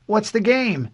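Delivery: put-on high, loud little-boy voice; child's bright-eyed question, pitched high; curious young boy. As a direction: ask like an eager kid. Boy asks about the game.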